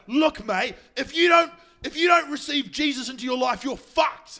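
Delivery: high, loud mock-preacher harangue; re-enacted full-voiced shout; retold yelling. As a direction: yell it like an exasperated street evangelist, voice pushed right up. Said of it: "I was, like, yelling at him".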